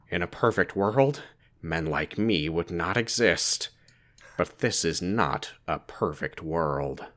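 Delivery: slow, low, hushed character read; Ben voice. Acted. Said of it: "I'll read it in Ben voice and Kyle voice".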